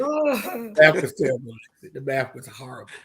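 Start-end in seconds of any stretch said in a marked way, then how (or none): 0.0-1.5 higher, through a laugh; chuckling repeat of the joke. Real, not acted. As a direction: repeat the punchline still laughing.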